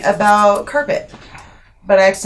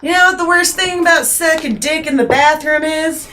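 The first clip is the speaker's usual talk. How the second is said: crude bar lady; 11 semitones higher than usual. Retold or imitated. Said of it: "looks at me and goes".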